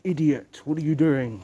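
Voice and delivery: mock an outraged neighbour telling someone off; imitated angry neighbour. Neighbours going at him for the noise, mock angry scolding. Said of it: "waving their fingers out in the air and going".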